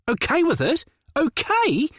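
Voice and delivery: mock the idea with scorn; derisive snort. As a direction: brother scoffing at the suggestion, derisively. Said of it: "he snorted derisively".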